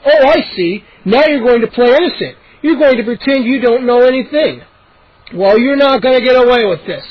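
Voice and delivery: angry accusation. A narrator's reading. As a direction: accuse him with scorn. Angry neighbor accuses dad.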